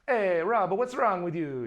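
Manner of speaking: loud, high, hammed-up South African; attempted South African accent. Imitating the colorist calling to Rob, loud South African accent. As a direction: call it out in a broad mock South African accent. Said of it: "he's a South African guy".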